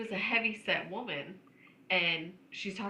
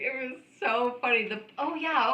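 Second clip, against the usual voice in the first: ordinary talk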